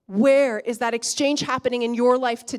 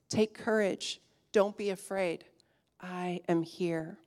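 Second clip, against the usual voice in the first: softer, intimate voice of comfort, slowing at the end; tender reassurance; 4 semitones lower, 8 dB quieter than usual. Acted. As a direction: speak it gently, like a promise whispered through the storm. Jesus speaks comfort in storm.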